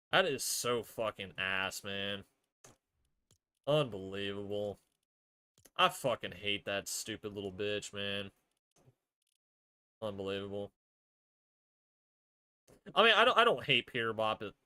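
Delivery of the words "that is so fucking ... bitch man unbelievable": low, soft, defeated grumbling; bitter dejection. Real, not acted. Fan hating the winner, bitterly low and soft. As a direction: say it low and quiet, drained of fight. Stunned bitterness after the rant.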